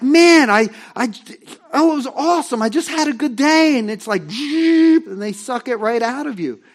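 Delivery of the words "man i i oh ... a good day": higher and louder, bubbling over; excited about a good day. Retold or imitated. Churchgoer telling a friend about a great day, loud and excited. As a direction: gush about how great the day was. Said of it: "And you're like".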